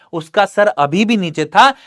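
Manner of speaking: usual speaking voice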